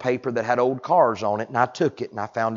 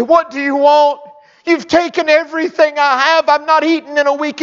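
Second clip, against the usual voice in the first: mama's voice; 11 dB louder than usual. Acted. Mother voiced in sermon story.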